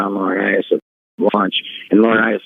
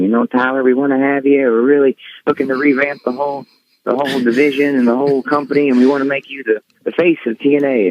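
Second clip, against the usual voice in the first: higher, coaxing sales pitch voice; butter him up in a higher voice; Dixie laying it on thick; 6 semitones higher than usual. Retold or imitated. Dixie Carter pitching to Tyler, higher and sweet-talking. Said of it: "she's really laying it in thick".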